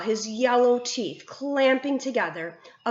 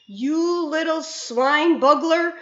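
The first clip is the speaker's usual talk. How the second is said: higher, louder, slow raging roar; furious roar; 7 semitones higher, 5 dB louder, slower than usual. A narrator's reading. Bloodbottler raging at the BFG, roar. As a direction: roar the insult at him. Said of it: "roared the blood bottler".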